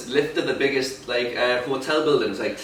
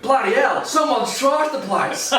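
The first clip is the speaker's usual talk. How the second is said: mock Aussie exclamation, pitched up and slow; imitating an Australian tourist, a touch high; Australian accent; 9 semitones higher, slower than usual. Australian guest finding trashed room, mock Aussie accent. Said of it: "they were Australian".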